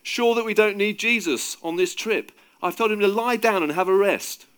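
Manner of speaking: loud, high call across the boat. A narrator's reading. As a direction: announce it to the whole group. Peter calls across to other disciples.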